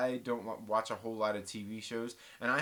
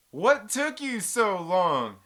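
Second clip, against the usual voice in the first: very slow, loud, higher-pitched taunt; 6 semitones higher, 8 dB louder, much slower than usual. Retold or imitated. Taunting the telemarketer who finally hung up, loud and drawn out. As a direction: belt it out, stretching every word. Same taunt repeated, much louder.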